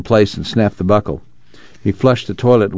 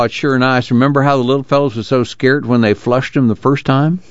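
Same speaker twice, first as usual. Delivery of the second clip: folksy father, pitched higher; chatty Pa; 6 semitones higher than usual. Acted. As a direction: reminisce in a lifted rural voice.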